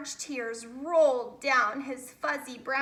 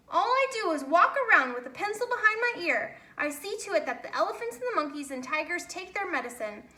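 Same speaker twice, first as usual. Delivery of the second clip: rushed complaint; 4 semitones higher, faster than usual. Acted. Boris complains, rushing.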